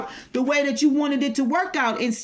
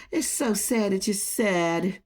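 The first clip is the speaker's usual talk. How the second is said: slow, softer, lower fake sympathy; slow mocking sympathy, dripping sarcasm; mock pity; 4 semitones lower, 4 dB quieter, slower than usual. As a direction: coo it like a sarcastic condolence. Taunting a rival with fake pity.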